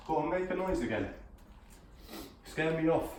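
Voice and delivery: challenging the spirit to scare him; taunting. Real, not acted. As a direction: dare the ghost to show itself. Taunting the ghost.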